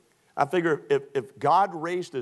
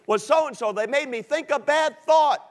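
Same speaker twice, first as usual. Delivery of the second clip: excuse maker; 10 semitones higher, 5 dB louder than usual. Retold or imitated. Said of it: "Liar, liar, pants on fire".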